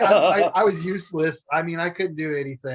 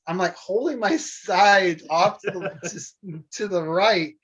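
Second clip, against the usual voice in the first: ordinary talk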